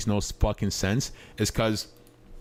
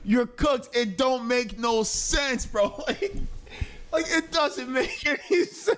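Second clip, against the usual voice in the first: exasperated laughing rant; 12 semitones higher, slower than usual. Real, not acted. Streamer ranting at viewer.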